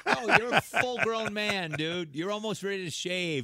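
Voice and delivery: loud, high-pitched protest; hammed-up parental outrage; mock exasperated dad. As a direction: refuse a grown son in comic disbelief. Dad refuses teenage son in bed.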